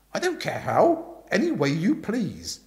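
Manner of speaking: loud, forceful Aunt Dahlia voice; bossy aunt telling Bertie the gossip, loud and brisk; booming aunt. Acted.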